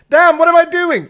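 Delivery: sudden loud, high stressed outburst. Real, not acted. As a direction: blurt it out in real panic at the game. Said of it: "I don't know why I stressed out there".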